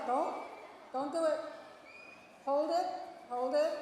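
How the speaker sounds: loud, insistent commands; urgent holding back. Real, not acted. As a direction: keep the crowd holding on, loudly. Holding audience back before scream.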